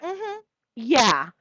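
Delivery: a high, flat mm-hmm yeah; imitating Tracy's dry reply; mock Tracy. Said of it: "You're like".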